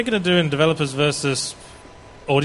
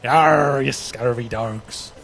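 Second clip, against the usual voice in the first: pirate voice; 5 semitones lower, much slower than usual. Retold or imitated. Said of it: "Bristol is a".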